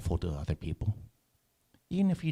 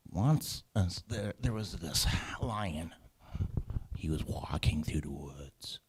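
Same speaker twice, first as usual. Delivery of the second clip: storytelling hush; 5 dB quieter than usual. A narrator's reading. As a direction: draw the children in with a hushed tale. Story narration to children.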